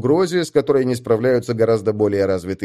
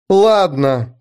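louder grumbled okay; grumbling; 6 semitones higher, 5 dB louder than usual. Acted. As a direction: mutter it grudgingly. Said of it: "буркнула Эста".

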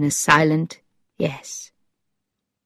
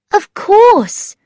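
very high, loud assent; Thetis, eager; 5 dB louder than usual. A narrator's reading. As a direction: agree instantly, pitched high.

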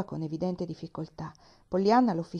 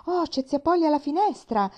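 higher and louder, calling out; excited cry; 9 semitones higher, 7 dB louder than usual. A narrator's reading. Girl spotting her aunt at the window, crying out higher and louder. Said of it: "gridò Pollyanna".

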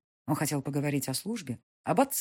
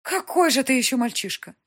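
обречённо; 10 semitones higher, 7 dB louder than usual. Acted. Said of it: "обречённо покачала головой матушка".

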